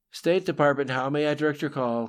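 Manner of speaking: a hint of a female operator on the line; second female operator. A narrator's reading. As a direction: pitch it up for a switchboard greeting. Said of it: "came a second female voice over the phone".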